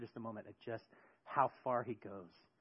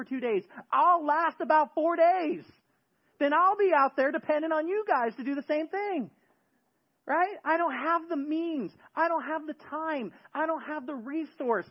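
high, loud pleading lament; preacher pleading with Jesus, loud and high; anguished preaching; 12 semitones higher, 9 dB louder than usual. Acted. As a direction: cry out your helplessness to God.

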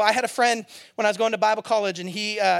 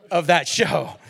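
voiced, a bit louder, laughing through it; laughing retort; 7 semitones lower, 3 dB louder, slower than usual. Pastor repeats his retort to the family, laughing. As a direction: land it with a laugh.

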